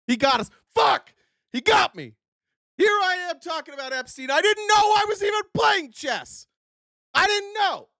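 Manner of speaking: high, shouted hammed-up dismay; mock outrage. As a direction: yell like you've been fooled. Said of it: "mock despair at being tricked".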